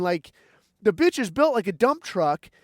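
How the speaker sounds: ordinary talk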